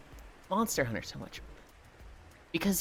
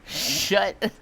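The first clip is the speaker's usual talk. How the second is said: ordinary talk, nothing marked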